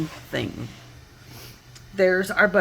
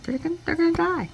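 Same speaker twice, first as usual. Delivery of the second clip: high, fast, panicky mock wail; imitating a frightened lady, high and rushed; frantic panic; 12 semitones higher, faster than usual. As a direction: blurt it out in high alarm.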